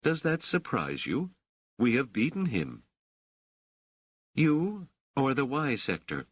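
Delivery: a light touch of proud poise; lightly voiced self-assured Rochelle. A narrator's reading. Rochelle tells guests of her victory, lightly voiced.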